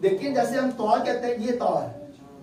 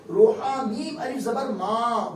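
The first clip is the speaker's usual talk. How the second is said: normal talking voice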